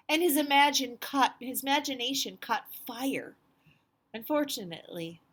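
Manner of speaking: put-on picture book read to children; storybook narration. A narrator's reading. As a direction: tell it to the kids with big energy. Reads the picture book aloud.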